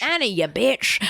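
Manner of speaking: broad Australian twang on the line; Australian accent. Pretend. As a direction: say it like a cheeky Aussie orphan. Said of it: "In Australian accent".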